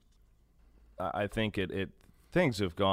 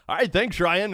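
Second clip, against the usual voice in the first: high, louder mock announcer sign-on; Denny Matthews catchphrase; 11 semitones higher, 7 dB louder than usual. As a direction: toss it off like the booth announcer, laughing. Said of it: "I so badly wanted to say".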